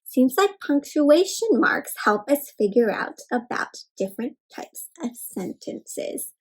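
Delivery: usual speaking voice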